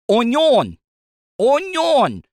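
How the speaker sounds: high, loud "Onion!" in a mock Creole chef voice; mock onion chef shout. Mimicking the onion chef at the drive-through, high and loud. Fast food worker mangles onion.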